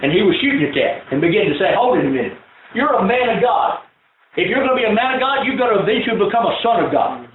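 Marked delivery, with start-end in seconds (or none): 1.8-7.2 preaching at full force. Acted. Pastor quoting his mentor, preaching with force. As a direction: drive it home like a sermon.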